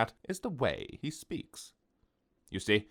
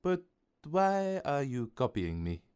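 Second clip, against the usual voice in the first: put-on higher, French-accented gentle question; kindly French baker; 6 semitones higher than usual. A narrator's reading. Baker asking the customer why he copies her, kindly French voice. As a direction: ask kindly in a lifted French voice. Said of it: "she said kindly".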